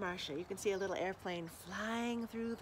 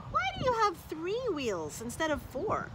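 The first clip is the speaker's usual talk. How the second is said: teasing brother truck; 8 semitones higher, 3 dB louder than usual. Acted. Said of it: "asked one of her brothers".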